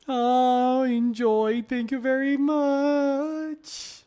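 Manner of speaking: high slow sing-song thank you; effusive thanks. Real, not acted. Streamer thanks the artist, high sing-song gratitude. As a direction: gush your thanks sweetly.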